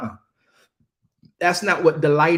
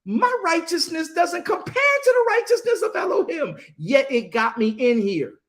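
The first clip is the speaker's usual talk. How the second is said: amazed exclamation; 12 semitones higher than usual. Retold or imitated. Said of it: "he gets there and says".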